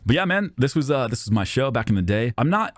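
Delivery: normal talking voice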